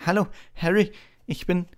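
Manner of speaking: put-on high, eager boy introducing himself; halting, breathless high voice; breathless Colin. A narrator's reading. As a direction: stammer out a shy, high-pitched greeting. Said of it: "sagte er atemlos".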